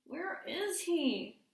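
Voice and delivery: put-on slow high child's question; a small kid looking around; slow high child asking where the bear went; worried child.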